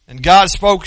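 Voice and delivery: ordinary talk, nothing marked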